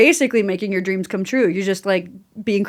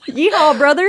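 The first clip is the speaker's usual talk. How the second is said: higher, louder mock cowboy holler; cowboy yeehaw; 6 semitones higher, 4 dB louder than usual. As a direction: play a hammed-up Southern cowboy. Joking cowboy call.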